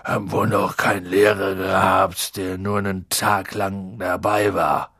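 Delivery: low, tipsy, glum giant; bräsige Stimme. A narrator's reading. Drunk gamekeeper laments his short teaching job, low and bräsig. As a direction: mumble it low, sodden with drink. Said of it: "mit bräsiger Stimme".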